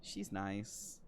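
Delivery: hesitant, awkward politeness. Acted. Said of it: "she's kind of really awkward about everything".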